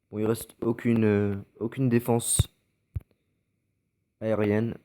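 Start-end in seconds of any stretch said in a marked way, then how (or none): none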